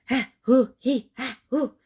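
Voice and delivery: high, loud mock hyperventilating; panicked breathing. Spooked captive panting outside the cave. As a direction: pant in short frightened gasps. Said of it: "is going like".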